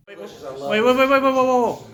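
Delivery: loud, high rapid-fire calling out; urgent interruption. Real, not acted. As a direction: shout over your buddies to stop them. Halting friends as they start.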